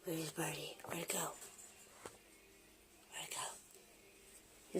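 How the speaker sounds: hushed whisper in the dark; whisper, scared to be heard. Real, not acted. Creeping in dark basement.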